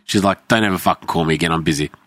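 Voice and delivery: ordinary talk, nothing marked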